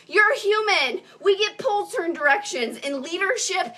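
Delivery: ordinary talk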